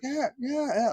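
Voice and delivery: high mock yelps; freaked-out cries. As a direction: imitate an upset child's high cries. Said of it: "I'm just all freaked out".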